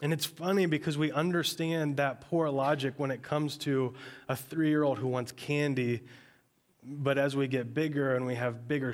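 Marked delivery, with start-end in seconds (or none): none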